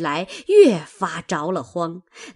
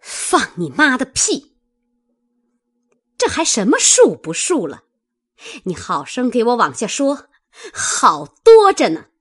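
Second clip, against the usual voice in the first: high, angry rebuke ending loud; spitting scolding; 4 semitones higher, 4 dB louder than usual. A narrator's reading. Mistress ordering the servant to keep confessing, angry and high-pitched. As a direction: snap impatiently and order him to go on. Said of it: "凤姐儿啐道".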